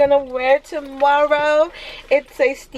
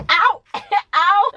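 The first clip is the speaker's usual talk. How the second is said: sharp high yelp of pain, laughing on the second; high little ow with a laugh; 10 semitones higher than usual. Real, not acted. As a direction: cry out ow, then laugh it off. Sudden pain, yelping.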